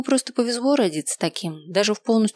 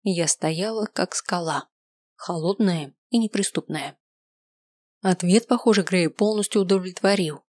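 usual speaking voice

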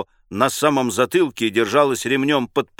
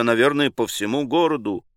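usual speaking voice

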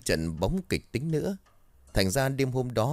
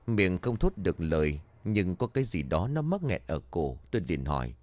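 ordinary delivery, nothing marked

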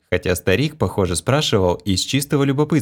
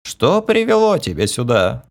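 put-on higher creaky old man; 5 semitones higher than usual. A narrator's reading. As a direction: ask like a bored old mage. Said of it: "проскрипел старик".

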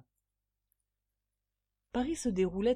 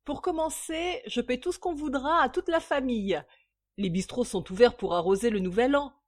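raised, lively, cheery offer; joyful; 6 semitones higher, 7 dB louder than usual. A narrator's reading. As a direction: announce it merrily.

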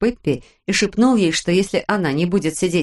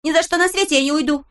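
defiant exclaim; 9 semitones higher, faster than usual. A narrator's reading. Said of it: "воскликнула Пеппи".

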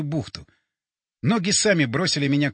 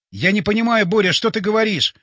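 higher, louder, frantic appeal; frantic questioning; 5 semitones higher, 5 dB louder than usual. A narrator's reading. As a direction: press a friend for an answer. Straining to understand dying friend.

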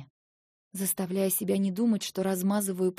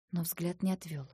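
plain reading voice

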